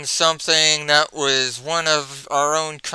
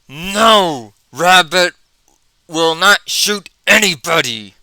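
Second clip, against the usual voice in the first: loud, raised angry monster voice; angry Hulk; 4 semitones higher, 5 dB louder than usual. Acted. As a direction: bellow a furious refusal. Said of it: "Hulk gets really angry".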